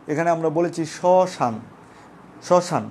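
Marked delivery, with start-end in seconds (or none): 1.0-1.5 শ্মশান slowly, stopping on n; silent final vowel. Shown as a demonstration. Teacher showing there is no o, শ্মশান ending on n. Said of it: "ও এর উচ্চারণ করি না". 2.5-2.8 শ্মশান stopped on the n; silent final vowel. Shown as a demonstration. Teacher showing where we stop, শ্মশান with no final vowel. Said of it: "এখানে আমরা থেমে গেছি".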